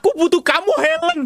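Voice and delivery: rising pleading call to Helen; dramatic longing. Acted. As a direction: beg for her like a lost lover. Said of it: "Ceritanya gitu".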